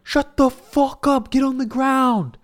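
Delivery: high, slow shouted threat; robber yelling. Retold or imitated. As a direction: bark orders like a robber. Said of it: "And he's like".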